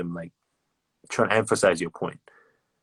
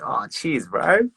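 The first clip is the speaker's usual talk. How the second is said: cheery thanks; 6 semitones higher, slower than usual. Retold or imitated. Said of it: "I'm like".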